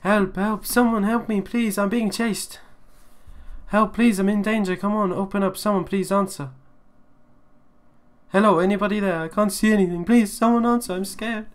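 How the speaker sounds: high, loud frightened pleading; panicked cries for help. Acted. Chased boy begs someone to open up, high panicked cries.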